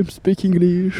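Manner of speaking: slow, showy English accent, lightly mocking; mock posh English. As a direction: put on airs speaking English. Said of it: "c'est les gens qui parlent bien anglais". (imitation moqueuse d'un accent anglais chic)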